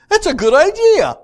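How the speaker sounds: high, loud, hammed-up eager agreement; mock enthusiasm. Moses answering the people's request with mock enthusiasm. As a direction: gush it like a too-eager yes-man.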